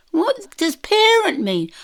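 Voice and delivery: high, loud, slow retold query; acted puzzled question. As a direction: stretch out a baffled question, acted.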